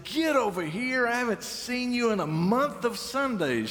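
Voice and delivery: high, delighted grandma call; grandmother's greeting. Retold or imitated. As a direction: call them in like a doting grandmother. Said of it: "She talked like that".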